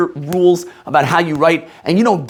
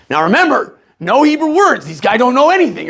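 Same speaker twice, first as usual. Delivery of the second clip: higher-pitched, emphatic warning; Rabbi Klein; 6 semitones higher than usual. Retold or imitated. Rabbi warning the teacher about his students, lightly imitated emphatic warning. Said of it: "Rabbi Klein was like".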